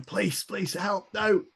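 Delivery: high, drawn-out plea at normal volume; mock cry for help. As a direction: act out a desperate call for help. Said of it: "as I'm shouting".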